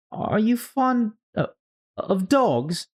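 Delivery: very high, hesitant question; Alice voice. Acted. Alice hastily changing the subject, very high and hesitant. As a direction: fumble for a safer topic.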